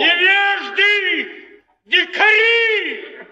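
indignant cry. Acted. Actor rails at the others.

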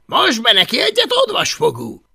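put-on loud, high, swaggering boy leader; bossy bully leader. Boastful Viking boy bossing the others, loud and high. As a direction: bark orders like the self-made chief.

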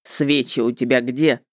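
low, matter-of-fact male voice; businesslike witcher. A narrator's reading. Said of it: "деловито спросил".

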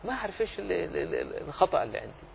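soft, weary mumble; mildly imitating an exhausted student; a quietly imitated tired old man. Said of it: "بعد أن تعب قال".

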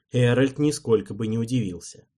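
plain reading voice

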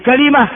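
كلمة as kalima; full variant. Shown as a demonstration. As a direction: say كلمة with three syllables, a then i. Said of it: "ثلاث لغات". (كلمة بفتح الكاف وكسر اللام)

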